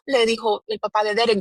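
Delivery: ordinary talk, nothing marked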